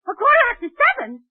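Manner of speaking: the penny drops, she's late; alarmed double take. Acted. Realises she is late.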